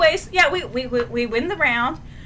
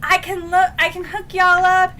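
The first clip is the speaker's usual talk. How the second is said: higher, over-friendly mock hello; chirpy friendly greeting; 5 semitones higher, 4 dB louder than usual. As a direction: imitate a character casually offering help. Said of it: "Matthias turning around and be like".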